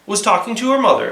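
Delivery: ordinary delivery, nothing marked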